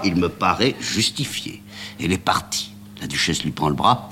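ordinary delivery

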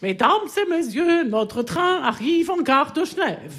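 high, slow mock announcement voice; train conductor. Narrator mimicking the conductor's announcement. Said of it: "La voix du contrôleur".

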